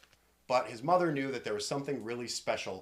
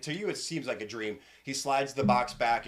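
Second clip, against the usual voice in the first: ordinary delivery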